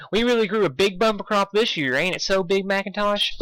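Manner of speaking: proud country pony; Applejack voice. Acted.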